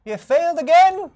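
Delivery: high-voiced old rabbit priest greeting survivors; old rabbit folk. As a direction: pitch way up for a doddery old rabbit.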